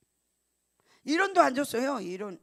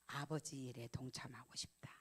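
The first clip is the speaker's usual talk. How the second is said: moved whisper; 6 semitones lower, 10 dB quieter than usual. Retold or imitated. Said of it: "하는 말이 뭔지 아세요".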